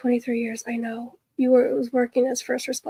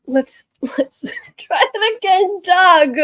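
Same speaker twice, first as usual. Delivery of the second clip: giggling; 6 semitones higher, 7 dB louder, slower than usual. Real, not acted. Laughing fit mid-stream.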